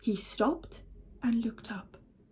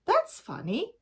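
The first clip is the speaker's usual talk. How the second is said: puzzled; 8 semitones higher than usual. Acted. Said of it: "he said".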